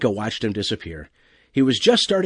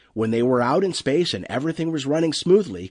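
ordinary delivery, nothing marked

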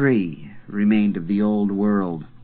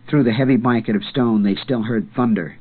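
plain reading voice

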